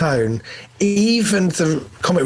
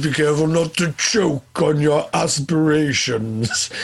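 Darth Vader. Retold or imitated. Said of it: "And he kinda goes".

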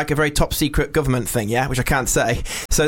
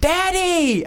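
put-on high squealed child's cry of Daddy; much higher excited shout of Daddy; Elf's Daddy. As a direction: squeal it like Buddy the elf. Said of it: "that scene in Elf where he goes".

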